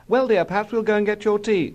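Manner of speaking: high-pitched housewifely mother; Mummy's voice. A narrator's reading. Mother talks tea to busy husband, Mummy's voice.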